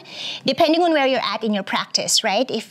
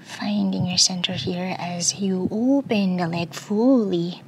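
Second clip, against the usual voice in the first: whispering; slower than usual. Real, not acted. Soft yoga cue.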